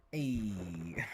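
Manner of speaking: the Fonz impression. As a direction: say "Hey" like the Fonz, cool and laid-back. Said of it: "That's the Fonz".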